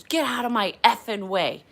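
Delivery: mock-furious shove of a line; angry order. Passenger rushes at the flight attendant, angry order. Retold passenger rushing at her.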